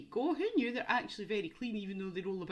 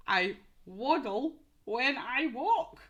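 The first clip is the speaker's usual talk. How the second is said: slow, louder clue in the animal's voice; slow clue read; 3 semitones higher, 6 dB louder, slower than usual. Shown as a demonstration. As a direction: spell out the clue for little ones.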